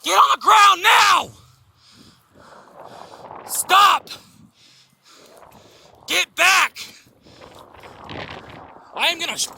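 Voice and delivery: very high, loud commands; shouted surrender demands. Real, not acted. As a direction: yell every order at full force. Officer shouting commands after shots.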